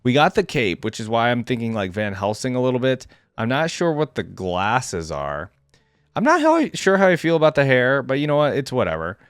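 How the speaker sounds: ordinary delivery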